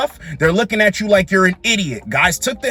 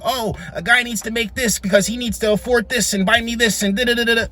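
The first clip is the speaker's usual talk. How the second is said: higher, mock-demanding women's voice; mocking imitation; 4 semitones higher, 3 dB quieter than usual. Commentator imitating women listing what a guy needs, mocking. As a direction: reel off the demands in a higher mimicking voice.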